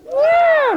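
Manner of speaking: high, loud falling meow; scared cat meow. Pretend. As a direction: squeal it like a frightened kitten. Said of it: "And then it's a scared cat".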